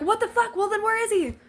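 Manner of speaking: high-pitched, acted-out outrage; frantic demand. Retold frantic demand to staff.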